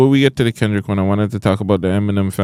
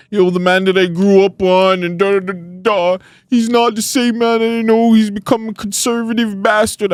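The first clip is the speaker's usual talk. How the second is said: high, worked-up mimicry of outraged fans; mock outrage in a raised, high voice; fans going off; 12 semitones higher, 3 dB louder than usual. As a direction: imitate aggrieved fans ranting, pitched well up. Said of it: "they went off on this man".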